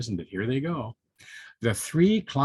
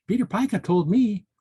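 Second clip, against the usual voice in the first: high, louder chirp; 9 semitones higher, 6 dB louder than usual. A narrator's reading. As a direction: chirp it out like an eager bird. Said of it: "chirped Tammy Ptarmigan".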